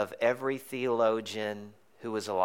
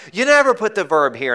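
higher, louder mock teacher scolding; 8 semitones higher, 9 dB louder than usual. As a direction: correct the student firmly. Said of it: "And they were like".

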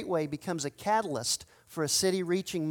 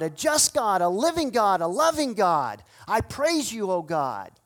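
bold praise prayer; 3 semitones higher, 4 dB louder than usual. Acted. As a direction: proclaim his praise boldly to God. Nehemiah's praise prayer voiced.

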